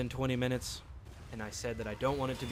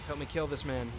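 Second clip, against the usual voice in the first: ordinary delivery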